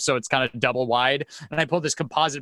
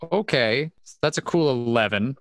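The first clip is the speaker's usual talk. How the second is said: usual speaking voice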